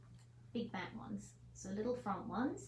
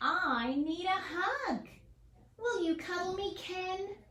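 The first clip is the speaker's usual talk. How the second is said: slow, louder little hedgehog begging; pleading hedgehog; 9 semitones higher, 4 dB louder than usual. Acted. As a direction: ask for a hug, longing and hopeful. Hedgehog asks again.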